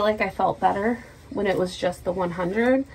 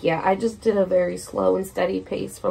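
usual speaking voice

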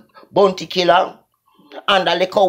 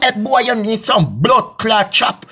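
ordinary delivery

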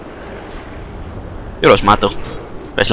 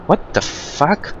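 stunned whisper; 7 semitones higher, 5 dB quieter, much slower than usual. Real, not acted. Shocked at strange game scene.

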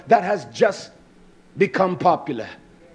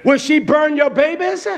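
high, raised, driving preacher's voice; impassioned preaching; 7 semitones higher, 5 dB louder than usual. Real, not acted. Preacher challenging congregation about America's bombings, impassioned preaching. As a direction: pound out challenge after challenge.

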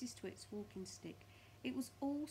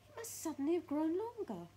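high, loud bewildered voice; Mrs Twit alarmed; 9 semitones higher, 8 dB louder than usual. Acted. Mrs Twit staring at her walking stick, high alarmed voice. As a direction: pitch it up in loud bafflement.

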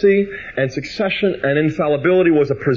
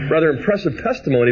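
usual speaking voice

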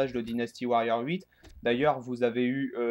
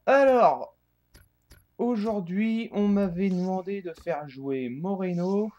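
energetic intro; 5 semitones higher, 4 dB louder than usual. Real, not acted. Restarting the intro upbeat.

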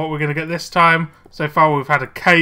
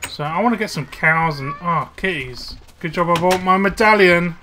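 ordinary delivery

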